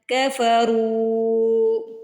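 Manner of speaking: كَفَرُوا with a light kaf and a full heavy ra; thin kaf, heavy ra. Shown as a demonstration. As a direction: stretch the رو in كَفَرُوا slowly. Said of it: "کاف کو باریک ... را کو موٹا". (كَفَرُوا میں کاف باریک، را موٹا)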